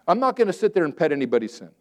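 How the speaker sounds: ordinary talk, nothing marked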